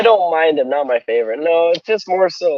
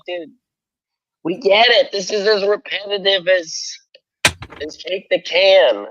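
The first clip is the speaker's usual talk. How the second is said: ordinary delivery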